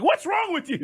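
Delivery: baffled at the dog. Retold or imitated. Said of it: "you always wonder".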